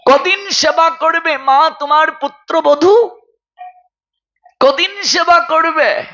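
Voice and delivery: high-pitched preacher's questioning; plaintive preacher putting a pointed question; impassioned appeal. Real, not acted. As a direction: ask it high, as a ringing challenge. Preacher's impassioned challenge to a mother.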